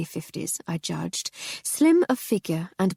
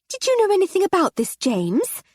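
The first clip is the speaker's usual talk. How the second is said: high, loud, fast and sharp; sharp demand; 13 semitones higher, 5 dB louder than usual. A narrator's reading. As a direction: snap the question at her husband. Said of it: "she asked her husband sharply".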